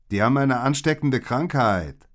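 high, loud, insistent reply; loud cover story. A narrator's reading. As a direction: call the lie back loudly. Villain loudly answers passer-by with lie. (laut und eindringlich gelogen)

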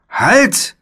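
sudden high, loud cry; commanding shout. A narrator's reading. Philipp halting the charging knight, a high urgent shout. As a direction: shout to stop an attacker.

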